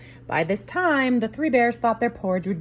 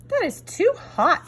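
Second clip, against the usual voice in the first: Goldilocks voice; 9 semitones higher, slower than usual. Acted. Goldilocks tasting porridge.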